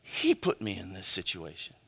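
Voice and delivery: a quiet mock grievance; muttered complaint. Someone blaming God, muttered under the breath.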